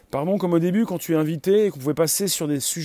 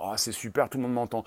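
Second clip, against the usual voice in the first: lower, softer aside to oneself; hushed delight; 4 semitones lower, 6 dB quieter, faster than usual. Acted. Host playing at a mic check, hushed delight. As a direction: marvel quietly, in a low voice. Acted self-talk on stage, pleased.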